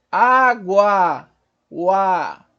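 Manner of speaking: "Água" loud, each A held long; drawn-out teaching demo of the A in "Água"; sustained A. Shown as a demonstration. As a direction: stretch "Água", then repeat its long final "A". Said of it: "Observa como se sustenta o A".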